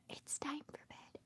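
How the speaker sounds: soft whisper; whispered mama's secret. Acted. Mother voices mama cow's whispered secret to the baby. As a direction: tell it like a secret. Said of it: "her secret".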